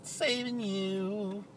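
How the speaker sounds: high, slow sneer; drawn-out sneering mockery. Mocking repeat of plate.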